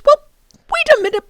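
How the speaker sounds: surprised high exclamation; startled professor. Acted. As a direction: jump up in pitch with surprise. Professor voice, higher second take.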